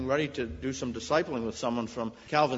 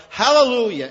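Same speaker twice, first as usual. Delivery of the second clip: loud and high, ringing out; preacher crying out in joy; exultant hallelujah; 7 semitones higher, 12 dB louder than usual. Real, not acted. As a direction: shout it in praise. Sermon climax praising God.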